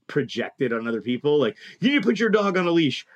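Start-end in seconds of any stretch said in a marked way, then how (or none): 1.8-3.1 quick, high-pitched nagging demand; mock scolding. Mimicking people who assume every dog is aggressive, mock scolding. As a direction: imitate a bossy dog person telling someone off.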